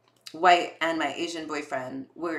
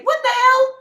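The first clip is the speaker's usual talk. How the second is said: much higher, louder shocked yelp; 10 dB louder than usual. Pretend. Customer hearing the insult, shocked yelp. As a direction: yelp in disbelief.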